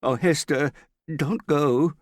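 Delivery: high, loud, alarmed plea; frightened pleading. A narrator's reading. Morgan begs retreating Hester to stay, terrified. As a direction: beg someone urgently to stay. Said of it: "watching her in terror".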